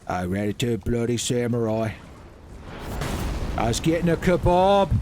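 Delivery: put-on an injured British bloke with a poor accent; bad British accent. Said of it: "doing a bad impression. A bad accent".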